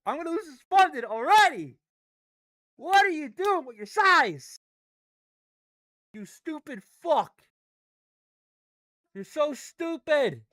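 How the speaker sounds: loud, high screaming rant; furious yelling. Real, not acted. Trader losing his funding, screaming at the chart. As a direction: scream it at the market, out of control.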